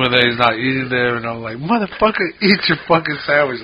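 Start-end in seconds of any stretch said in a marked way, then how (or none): 1.6-3.6 higher-pitched mock snap at a picky friend; retold angry outburst. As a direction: replay the moment you lost patience, pitched up. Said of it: "I was getting all pissed off at him".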